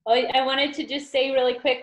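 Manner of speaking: normal talking voice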